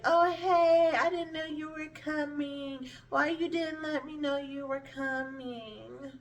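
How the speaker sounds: high, put-on innocent surprise; imitating a godmother who pretends nothing is wrong; fake-friendly godmom. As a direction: play it fake-nice and caught off guard. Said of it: "she tries to be like".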